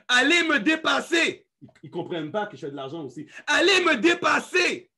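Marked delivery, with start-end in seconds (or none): 0.1-1.5 high, loud rallying shout; shouted exhortation. Real, not acted. Exhorte son équipe à le dépasser. 3.5-4.9 high, loud rallying cry; shouted exhortation. Real, not acted. Répète son exhortation à l'équipe.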